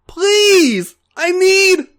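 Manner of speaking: high, loud, desperate pleading cry; a high strained plea; pleading groan. A narrator's reading. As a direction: beg out loud, breathless with need. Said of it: "A J groaned".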